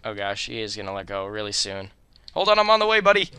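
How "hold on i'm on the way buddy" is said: loud high urgent call; urgent shout. Real, not acted. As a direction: shout out in a hurry. Rushing to save a character.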